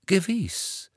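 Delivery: a hint of pursed-lip disdain; clipped through pursed lips. A narrator's reading. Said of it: "mit geschürzten Lippen". (mit geschürzten Lippen)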